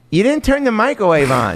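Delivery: high, matter-of-fact mom voice; imitating mom. Mom voiced catching him out.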